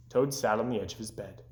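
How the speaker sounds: ordinary delivery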